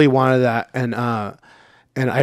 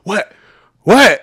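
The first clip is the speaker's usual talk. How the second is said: startled what; 7 semitones higher, 8 dB louder than usual. Retold or imitated. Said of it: "I'm like".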